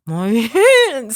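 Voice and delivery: acted-out pained protest; pained yelp. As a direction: imitate someone whose hand is being crushed. Groom in pain, retold.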